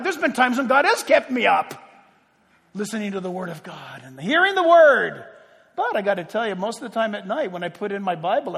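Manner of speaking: usual speaking voice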